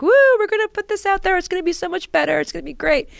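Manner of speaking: mock the team's naive hype; giddy hype. Parodying the team before they set hypotheses, high and gushing. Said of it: "willy-nilly like".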